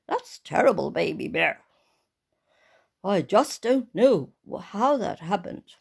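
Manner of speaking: low, loud papa bear; big deep bear voice; Daddy Bear voice. Acted. Daddy Bear answers his crying child, deep voice.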